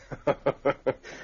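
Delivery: sly villain chuckle; gloating laugh. Acted. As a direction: relish the ambush plan. Villain gloats over plan.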